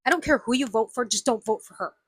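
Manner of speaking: usual speaking voice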